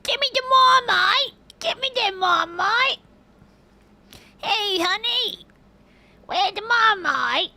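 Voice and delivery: high-pitched, hammed-up Australian accent. Shown as a demonstration. Host demands Marmite from honey in a high Australian accent. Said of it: "That's my Australian accent".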